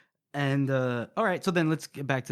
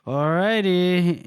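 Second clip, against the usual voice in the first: long drawn-out, higher mock concession; reluctant agreement; 4 semitones higher, 4 dB louder, much slower than usual. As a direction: drag the word out reluctantly. Jalahorn reluctantly gives in.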